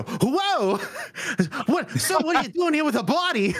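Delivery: high, shocked outcry; shocked servant. Acted. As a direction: servant startled to see a body carried to the manor, crying out high. Servants see the body.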